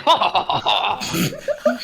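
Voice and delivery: high cackling villain laugh; evil laugh. Pretend. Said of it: "I'm gonna do an evil laugh".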